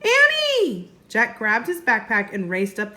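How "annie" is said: high, loud yell; shout. Acted. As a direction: call out her name in alarm. Jack calls to sister.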